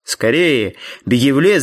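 ordinary delivery, nothing marked

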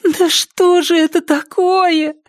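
exasperated high-pitched groan of a question; moaned in dismay. A narrator's reading. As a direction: wail it slowly in a very high voice. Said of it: "простонала Триша".

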